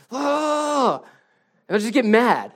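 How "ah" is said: high, loud exasperated "ah!"; frustrated kid's groan. Retold or imitated. Dad imitating his kids failing at a task, frustrated groan. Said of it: "I hear this".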